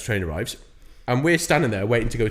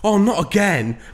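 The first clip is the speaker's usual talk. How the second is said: lightly voiced, higher weary bloke; mock weary groan; 9 semitones higher, 5 dB louder, slower than usual. Imitating the stranger who missed his train, lightly voiced. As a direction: sigh it like it happens every week. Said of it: "he starts laughing and he goes".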